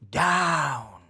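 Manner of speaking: ordinary delivery, nothing marked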